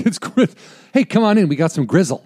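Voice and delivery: high, eager barker's invitation; joking restaurant welcome; mock steakhouse pitch. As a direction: call customers in with hammed-up cheer. Voicing a steakhouse inviting people in.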